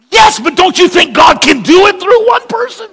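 pleading protest. Retold or imitated. Son insisting to his father, high pleading shout. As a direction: press the point hard and high.

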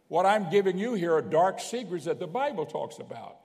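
normal talking voice

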